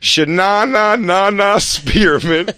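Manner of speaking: high, slow sing-song name; teasing a made-up-sounding name, high and slow; mocking name. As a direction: stretch the silly name out mockingly.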